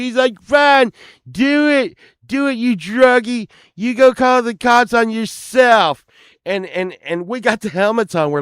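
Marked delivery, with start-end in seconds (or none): 0.4-6.0 high-pitched taunting yell; angry defiance. Retold or imitated. Friend daring the rock star to call police, angry yell. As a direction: hurl it back in mock fury. Retold friend defying threat.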